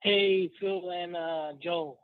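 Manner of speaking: usual speaking voice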